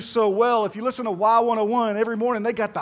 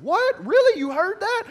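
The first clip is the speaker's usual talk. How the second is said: mock shock; 11 semitones higher than usual. Pastor feigning shock at the waitress's gossip, high incredulous. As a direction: play up the disbelief, pitch jumping high. Acting shocked in retold story.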